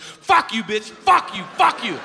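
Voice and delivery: high, repeated mock yells; mimicking a kid's uncontrolled swearing; imitated tic outburst. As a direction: fire off the curses in high bursts. Kid swears at substitute in tics.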